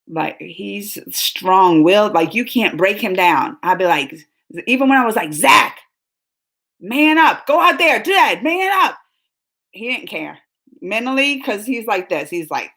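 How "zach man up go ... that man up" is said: loud pushy man up, ending in a shout; father urging son, ending in a yell; telling son man up. Retold or imitated. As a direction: push him hard, a coach's bark. Father pushing son to toughen.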